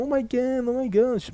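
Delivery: high, mocking squeal of a phone-addicted girl; mocking her squeal. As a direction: ham up her shocked gasp. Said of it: "you go".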